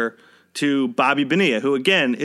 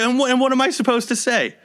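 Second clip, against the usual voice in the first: high, quick, stammered question; baffled disbelief; 9 semitones higher, faster than usual. Retold or imitated. Rental worker asking manager what to say, baffled. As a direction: blurt it out high and fast, at a loss.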